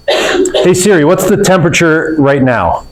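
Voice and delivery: ordinary talk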